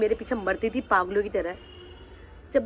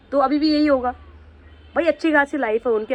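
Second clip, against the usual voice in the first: raised voice; 4 semitones higher, 5 dB louder than usual. Real, not acted. Angry rant at ex.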